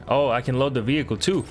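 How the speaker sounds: usual speaking voice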